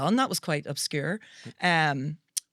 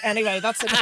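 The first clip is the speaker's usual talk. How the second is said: normal talking voice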